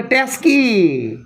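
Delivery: ট্যাক্সি stretched slow as টেস্কি; local mispronunciation. Shown as a demonstration. Teacher imitating how people say ট্যাক্সি. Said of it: "লোক বলে".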